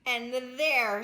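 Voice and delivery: ordinary delivery, nothing marked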